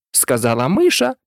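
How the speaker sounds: ordinary delivery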